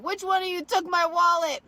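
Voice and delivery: loud, high retold yell; re-enacted shouted accusation; accusing yell. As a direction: call out the whole bar. Retold accusation causing a bar scene.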